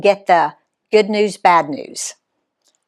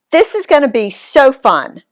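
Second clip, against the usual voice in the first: excited; 6 semitones higher, 4 dB louder than usual. A narrator's reading. Eager excitement.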